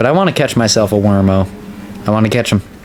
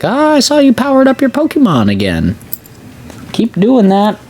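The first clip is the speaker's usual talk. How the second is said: sinister lady; 9 semitones higher than usual. Retold or imitated. Streamer mocks the phone-call lady, high sly villain voice. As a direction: ham up a sly scheming woman. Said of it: "It's like".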